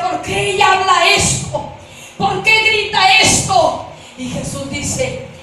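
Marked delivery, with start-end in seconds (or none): 0.0-3.9 loud, high demand for an answer; urgent questioning. Retold or imitated. Witness asking Jesus why the woman screams, urgent questioning. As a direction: ask it loudly, in alarm.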